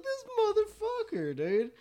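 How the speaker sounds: high, loud mock outrage; squeaky indignant cry; outraged exclaim. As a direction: exclaim in disbelief at the friend. Retelling friend blowing them off.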